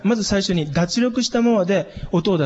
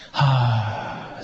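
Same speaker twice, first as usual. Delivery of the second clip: relaxed exhale; 5 semitones lower than usual. Shown as a demonstration. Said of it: "リラックスしながら音を吐いてる".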